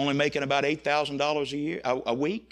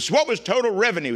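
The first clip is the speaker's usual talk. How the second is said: higher, louder and quicker, driving the point home; pointed retry; 6 semitones higher, 7 dB louder, faster than usual. Retold or imitated. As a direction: start over and press the question harder. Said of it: "let's start over again".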